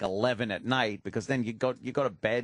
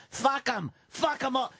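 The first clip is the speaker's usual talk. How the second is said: much higher, snarling puppet voice; dummy George snarling; 11 semitones higher than usual. Retold or imitated. Dummy George snapping back at Otto, high mock snarl. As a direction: pop up high as the nasty dummy. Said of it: "dummy answers Otto".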